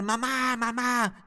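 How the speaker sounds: put-on child calling mom. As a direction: call out for mom like a three-year-old. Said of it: "yo empecé a gritar".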